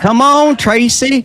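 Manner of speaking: loud, high call to come over. Real, not acted. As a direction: shout her over to the camera, high and loud. Calling wife to the show.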